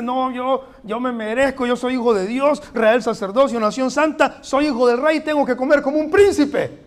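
loud, high, swelling mock boast; a hammed-up boastful believer; mock entitled boast. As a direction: puff up like a spoiled prince demanding his due. Preacher mocks entitled believer.

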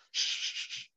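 whispered shushing; shushing coach. Retold or imitated. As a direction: hush the laughing boys in a whisper. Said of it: "he'd be like".

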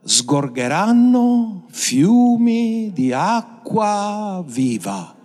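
high, very slow, drawn-out preaching; drawn-out solemn sermon quote; proclaiming scripture. Acted. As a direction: stretch the promise out over the crowd. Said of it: "come ha detto la scrittura".

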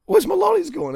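put-on upset eight-year-old. Boy at camp finds his lollies gone, high upset wail. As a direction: cry out like a kid who lost his sweets. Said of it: "and I was like".

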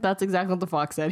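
ordinary delivery

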